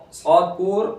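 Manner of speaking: normal talking voice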